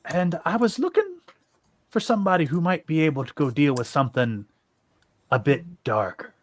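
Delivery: a weird, loose attempt at an Irish lilt; hint of an Irish accent; almost Irish accent. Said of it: "a weird, almost Irish accent for him".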